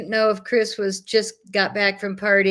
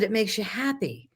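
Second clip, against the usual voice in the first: normal talking voice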